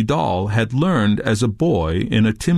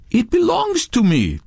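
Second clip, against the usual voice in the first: much higher, insistent protest; 13 semitones higher than usual. A narrator's reading. Touré disputing Redbeard's charge, insistent. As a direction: insist on ownership, pitch well up. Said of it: "Touré insisted".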